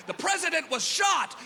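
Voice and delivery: put-on high, excited kid yell; kid's excited yell. Kid yelling news outside hedge, high excited cry. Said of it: "he yelled".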